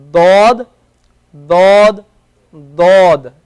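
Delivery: loud, heavy "Dod" with the tongue sides pressed up; the Arabic letter dod, said loudly; emphatic Arabic dod. Shown as a demonstration. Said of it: "So this is the letter dod".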